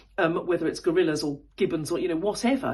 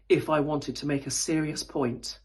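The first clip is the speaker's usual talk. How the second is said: low voice for a serious point; 5 semitones lower than usual. Shown as a demonstration. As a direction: talk down low, lower register. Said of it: "pitch my voice down here".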